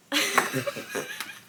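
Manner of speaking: sudden high burst of laughter. Real, not acted. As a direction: let out a squealing laugh. Bursts out laughing after the ending.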